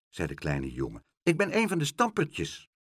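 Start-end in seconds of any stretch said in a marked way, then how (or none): 1.2-2.7 put-on high small child's voice; a lost little boy asking timidly. A narrator's reading. Lost boy asks Pluk the way, small high child voice.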